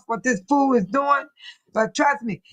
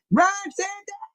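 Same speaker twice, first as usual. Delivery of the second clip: pitched up, echoing the line in a put-on voice; high mock echo; 7 semitones higher than usual. Shown as a demonstration. To viewers mocking Ron, high mock echo. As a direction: toss it back up high, mocking. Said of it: "repeats it in another voice".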